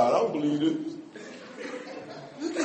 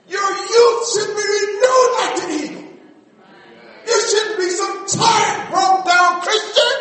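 higher, louder shouted preaching; full-force preaching; 8 semitones higher, 6 dB louder than usual. Acted. Preacher shouting encouragement to the congregation, full-force preaching. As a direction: lift the church with a rising shout.

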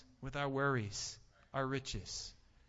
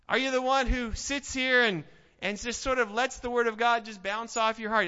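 preaching at full force; 8 semitones higher, 9 dB louder than usual. Acted. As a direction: press the question on the pews. Preacher challenges congregation.

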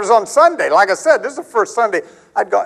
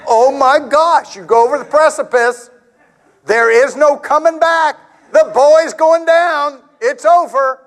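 mock panic; 7 semitones higher than usual. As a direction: ham up the fear of falling apart. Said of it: "I think".

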